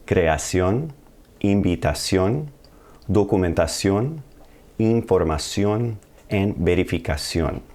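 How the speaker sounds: creación with stress on the final ó, Spanish vowels; careful Spanish teacher demo of -ción words; Spanish pronunciation. Shown as a demonstration. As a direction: say each -ción ending the Spanish way, stress on the last syllable. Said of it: "put the emphasis when we pronounce this word".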